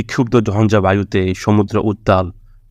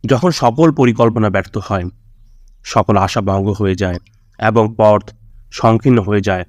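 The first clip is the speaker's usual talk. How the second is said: normal talking voice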